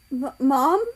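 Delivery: loud, high questioning cry; stammered cry. Acted. A young cat cries out to their mother, loud stammered cry. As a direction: cry it out with a stammer, rising at the end. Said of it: "he cried to her".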